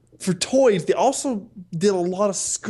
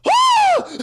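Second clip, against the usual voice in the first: high, loud mock cry of disbelief; mock shriek; 8 dB louder than usual. Friend reacting to Batman's line with a shriek. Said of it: "I was like".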